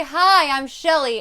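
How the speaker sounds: a confident hello. Shown as a demonstration. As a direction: announce yourself with confidence. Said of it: "you say it very confidently".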